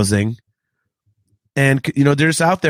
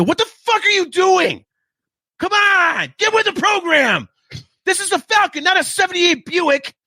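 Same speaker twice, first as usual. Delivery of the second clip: mock yell. Yelling at the stranger botching the Falcon ride, high-pitched. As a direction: rant like a furious pilot at a clueless copilot. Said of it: "I'm going to yell at somebody".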